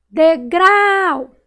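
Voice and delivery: "Degrau" with a loud, high, long GRAU; stretched stressed syllable. Shown as a demonstration. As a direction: call out "Degrau", holding GRAU. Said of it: "Qual que eu demorei mais falar".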